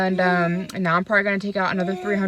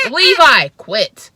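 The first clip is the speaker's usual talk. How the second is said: sharp scolding shout; 3 semitones higher, 13 dB louder, much slower than usual. Real, not acted. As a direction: shout the name high and loud to stop them. Snapping at misbehaving child.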